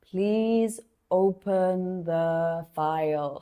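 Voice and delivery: slow, careful instruction; slow down each word for a learner; deliberately unhurried repeat; speaking slowly. Acted. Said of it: "please speak slowly".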